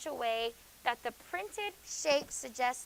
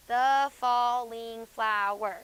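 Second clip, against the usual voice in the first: syllables counted out; 6 dB louder, much slower than usual. Shown as a demonstration. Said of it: "That's five".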